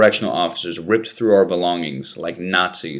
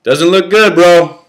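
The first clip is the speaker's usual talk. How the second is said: loud, higher yell; yelling back; 10 semitones higher, 11 dB louder, slower than usual. Acted. Prisoner yells back to his friend while escorted, loud. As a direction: shout it back down the hall. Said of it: "I yelled".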